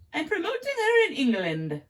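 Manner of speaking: high, put-on English accent, self-consciously bad; an amateur English accent for a British promoter. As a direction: try a shaky British accent and own that it's rough. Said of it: "Sorry, my accent's horrible".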